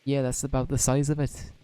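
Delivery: put-on lightly Welsh-accented fairy sidekick, chatty; Welsh Drippy voice. Drippy chats to Oliver in a mild Welsh accent.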